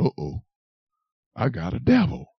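low, hushed alarm; acting out crestfallen realization; deflated dismay. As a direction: drop to a small, sinking voice. Retold dismayed realization after the rebuke.

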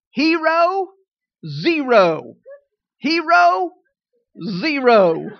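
loud swings, HERO high and zero falling; up-down cycle. Acted. Mimics the up and down cycle.

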